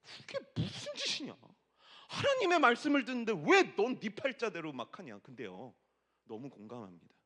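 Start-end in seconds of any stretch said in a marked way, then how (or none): 0.0-5.2 scolding himself. Retold or imitated. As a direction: play your own stern scolding voice. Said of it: "그래서 제가 뭐라고 했습니다".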